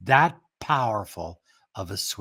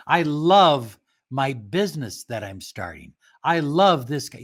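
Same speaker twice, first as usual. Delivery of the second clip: love said louder as the peak of each line; stress on love; 4 dB louder than usual. Shown as a demonstration. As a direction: punch love and let the rest drop. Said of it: "make it the high point of the sentence".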